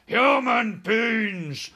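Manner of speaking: high, loud, very drawn-out yell; giant's yell. A narrator's reading. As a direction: yell it as an outraged giant, stretching each word. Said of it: "the flesh lump eater yelled".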